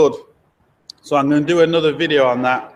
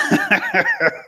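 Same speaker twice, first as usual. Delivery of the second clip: a sudden, high burst of laughter; short high-pitched laugh. Real, not acted. Laughing at diesel issues comment.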